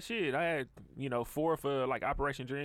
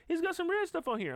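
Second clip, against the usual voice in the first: put-on very high kid voice; complaining child; 13 semitones higher than usual. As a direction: mimic a picky kid objecting to food. Voicing child complaining about sauce.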